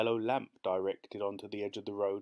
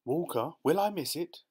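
higher, anxious question; worried wife checking with the butler; 8 semitones higher, 4 dB louder than usual. A narrator's reading. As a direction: ask anxiously, pitched up high.

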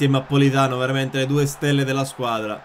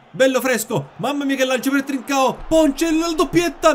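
high, loud rising commentary call; excited goal commentary; 12 semitones higher, 6 dB louder than usual. Real, not acted. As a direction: yell the chance as it happens. Live match commentary. (telecronaca esaltata)

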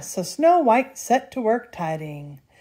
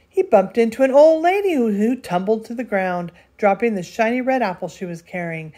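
ordinary delivery, nothing marked

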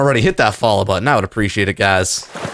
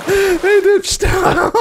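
slow, high laughing; much slower than usual. Real, not acted. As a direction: laugh it out slowly. Laughing at fleeing enemies.

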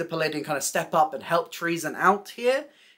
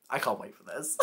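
giggling; 4 dB quieter than usual. Real, not acted. Giggling over favourite drag queens.

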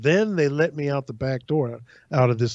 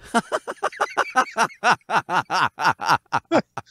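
laughter; 5 semitones higher than usual. Real, not acted. Laughs after the quote.

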